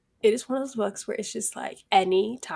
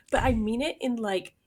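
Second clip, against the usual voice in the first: ordinary talk, nothing marked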